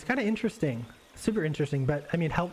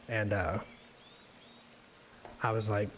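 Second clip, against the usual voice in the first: ordinary talk, nothing marked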